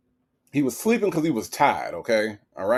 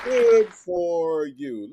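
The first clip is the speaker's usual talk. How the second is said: drawn-out, sarcastic and slow; slow mocking drawl; 4 semitones higher, much slower than usual. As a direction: stretch every word to mock her. Mocking repeat of the taunt.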